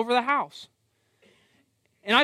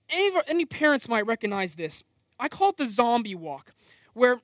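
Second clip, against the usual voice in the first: normal talking voice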